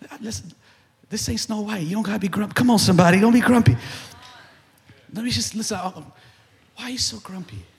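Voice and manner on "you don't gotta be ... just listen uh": ordinary talk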